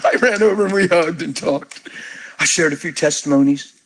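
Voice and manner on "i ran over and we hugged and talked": ordinary talk, nothing marked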